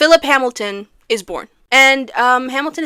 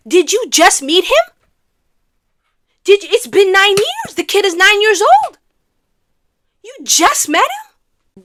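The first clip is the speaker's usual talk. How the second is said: high, loud disbelieving questions; incredulous outrage; 8 semitones higher, 6 dB louder than usual. Pretend. Recapper confronting Hamilton about his nine-year-old son, incredulous. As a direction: climb higher in appalled disbelief.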